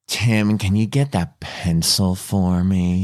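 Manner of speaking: low, slow suggestive request; pervy adult. Shown as a demonstration. Trying to make a harmless request to a co-host sound pervy. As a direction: drop the pitch and linger on an innocent line. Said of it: "let me try this".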